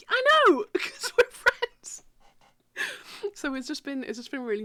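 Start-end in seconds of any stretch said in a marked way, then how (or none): 0.1-2.0 high, louder, laughing. Real, not acted. Answering her friend, laughing high. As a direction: squeak it out through laughter.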